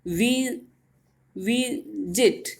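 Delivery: Visit softly, with वी drawn out before जिट; lengthened first syllable. Shown as a demonstration. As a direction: say वी alone, then visit slowly with a long वी. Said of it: "वी को थोड़ा हमने लंबा बोला है". (Visit में वी को लंबा खींचकर)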